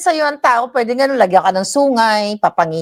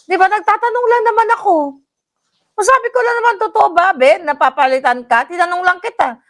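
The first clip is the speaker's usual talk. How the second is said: much higher-pitched mock-innocent protest; 8 semitones higher, faster than usual. Vlogger replaying her question to a rival, mock-innocent and high. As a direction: plead innocence in a raised voice.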